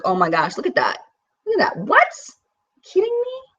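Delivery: squeaky high disbelieving "kidding me"; mock-shocked high exclamations; retold amazement. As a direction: replay the moment you were floored. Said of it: "where I was like".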